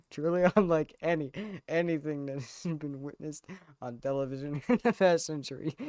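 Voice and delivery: rushed recital broken up by laughter; quick, giggling replay of a grand tribute; laughing self-imitation. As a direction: race through the speech while cracking up. Repeats own speech, cracking up afterwards.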